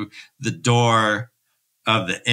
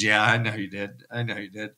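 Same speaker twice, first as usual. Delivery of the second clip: softer, real catch in the voice; fading, genuinely choked up; retelling the scene and getting emotional; 3 semitones lower, 6 dB quieter, faster than usual. Real, not acted. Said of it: "I'm getting a little choked up".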